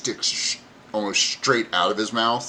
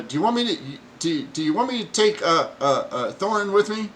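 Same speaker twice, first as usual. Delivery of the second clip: much higher, whiny goblin voice; goblin lackey; 10 semitones higher, faster than usual. Acted. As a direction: ask timidly like a little goblin. Goblin asks boss.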